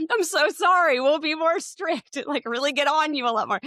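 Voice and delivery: high, loud sarcastic sorry; mocking apology to her son about the long leash. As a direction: pretend to be strict, clearly joking. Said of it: "I was like".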